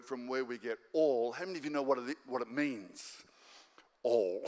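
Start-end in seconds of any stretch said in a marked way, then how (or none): 4.0-4.4 low, drawn-out punchline; deadpan comic reveal. Acted. Pastor revealing the Greek meaning, comically low. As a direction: drop low for the mock revelation.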